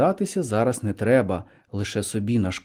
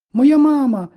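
put-on very high, loud girl's cry; excited high girlish call; ringing exclamation; 14 semitones higher, 8 dB louder than usual. A narrator's reading. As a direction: shout it out ringingly, pitched right up. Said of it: "дзвінко вигукнула Мавка".

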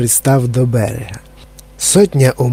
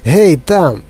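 shouting; 6 semitones higher, slower than usual. A narrator's reading. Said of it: "крикнув містер Вонка".

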